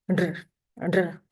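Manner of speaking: isolated "r", tongue tip up and lips a little rounded; voiced R sound. Shown as a demonstration. Teacher asks learners to listen and repeat, voiced "r". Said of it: "Listen and repeat".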